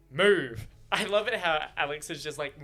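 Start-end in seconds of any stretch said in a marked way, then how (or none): 0.1-0.7 sudden loud one-word command; barked order. Acted. Alex ordering Shan out of his path, barked. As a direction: bark it to clear the way.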